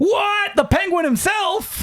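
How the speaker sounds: high-pitched, drawn-out disbelief; theatrical high-voiced amazement; mock astonishment. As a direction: feign shock at a big reveal. Mock astonishment at the name revealed.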